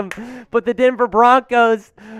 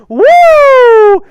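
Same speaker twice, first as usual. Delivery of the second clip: high, loud wrestling-legend whoop; Ric Flair woo; 13 semitones higher, 8 dB louder than usual. Shown as a demonstration. Asked by a viewer for a Ric Flair, a loud high woo. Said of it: "Give me two claps and a Ric Flair".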